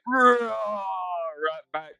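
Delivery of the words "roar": short high yelled roar; mock roar. To the rival player in a standoff, a high yelled roar. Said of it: "And I was like".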